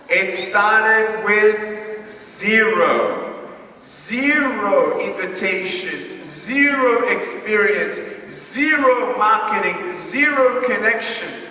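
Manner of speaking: each zero hit slow and falling; stress on zero. Acted. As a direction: pound out zero, zero, zero. Hammering the word zero.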